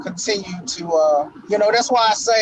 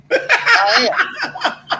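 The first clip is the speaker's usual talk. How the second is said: a high laughing squeak; 11 semitones higher than usual. Real, not acted. Joking to his co-host, laughing high. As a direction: laugh through the words.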